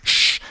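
loud, sharp "shh"; sudden interrupting shush; urgent shush. A narrator's reading. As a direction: cut in with a hiss. Huck cuts off the prayer.

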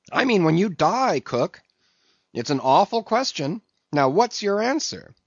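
high, urgent questioning; demanding mate. A narrator's reading. Mate asking the cook about death, demanding. Voices Stubb.

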